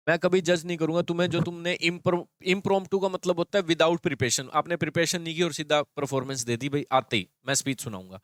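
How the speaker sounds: usual speaking voice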